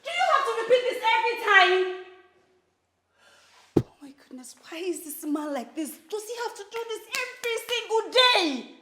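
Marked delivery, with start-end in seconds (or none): 0.0-2.0 high, fast, loud complaint; angry outburst. Acted. Wife yells during quarrel. 6.1-8.7 high, very loud, fed-up shouting; furious rant. Acted. Wife rants, sick and tired.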